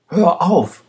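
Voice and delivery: high, desperate shout to stop; injured Rost screams at the guard to stop. A narrator's reading. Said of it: "schrie Rost".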